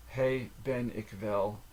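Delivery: slow, with each word set apart; drawn-out teaching enunciation; slow careful repeat. Shown as a demonstration. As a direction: say it word by word for a learner. Phrase repeated slowly, word by word.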